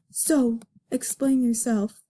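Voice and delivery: ordinary delivery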